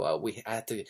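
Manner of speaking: ordinary talk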